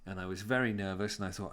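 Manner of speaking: ordinary delivery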